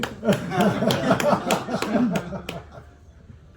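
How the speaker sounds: a loud, high burst of laughter that falls away. Real, not acted. Narrator laughing about the Big O song. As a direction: laugh out hard, then let it fade. Laugh after the Roy Orbison story.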